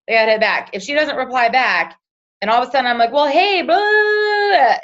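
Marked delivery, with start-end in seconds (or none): 3.1-4.8 high, loud, over-eager rush of a pitch; gushing sales pitch. Retold or imitated. Texting a friend who never replied, over-eager gush. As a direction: gush at her like a pushy seller. Mock pushy sales message.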